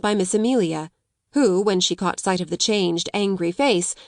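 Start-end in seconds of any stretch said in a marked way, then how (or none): none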